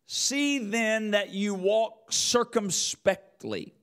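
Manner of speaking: slow, lifted reading of the verse; measured scripture recitation. Acted. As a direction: read it out like holy writ. Reads scripture verse aloud.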